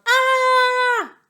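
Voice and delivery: high, loud shriek of fright; father startled by his son, shrieking; startled yell. Acted. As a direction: scream like you've been ambushed. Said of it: "The man yelled".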